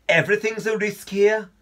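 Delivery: put-on a light Midlands accent; English Midlands accent, lightly done. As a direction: give it a hint of Midlands. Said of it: "who suddenly got a Midlands accent".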